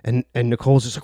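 normal talking voice

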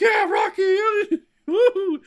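very high, shouted mock cheer; hammed-up Russian crowd whoop. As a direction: yell it like an excited fan. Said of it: "the Russian's like".

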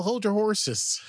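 high, loud chirpy order; hammed-up Tilly, pitched high and loud; Tilly impression. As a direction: fire it off high and bossy like Tilly. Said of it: "It's very Tilly".

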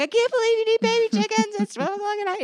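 high, loud exasperated parent rant; hammed-up fed-up parent scolding child; mock lost temper. Parent snapping at child over baby chickens, mock angry. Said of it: "lose your temper".

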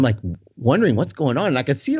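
ordinary talk

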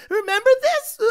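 high, loud squealing giggle; mock giddy fan. Fan grabbing a hat from a driver, giddy high squeal. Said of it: "grabbing a hat and go".

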